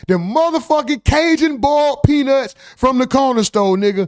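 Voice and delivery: high, hyped-up praise; excited. Real, not acted. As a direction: rave about it like a craving. Raving about boiled peanuts.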